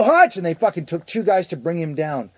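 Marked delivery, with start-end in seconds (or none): 0.0-0.4 high urgent yell; yelling for Hutch. Retold or imitated. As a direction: shout the name for help. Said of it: "And I go".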